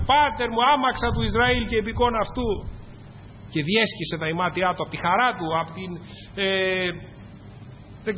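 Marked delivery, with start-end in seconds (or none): 0.1-2.5 mild high-pitched exultant call; a lightly acted congregational cry. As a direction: call it out higher, without full shouting. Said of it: "θα φωνάξουμε και θα αλλάξουμε".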